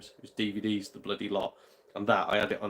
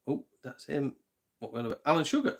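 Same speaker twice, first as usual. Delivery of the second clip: ordinary delivery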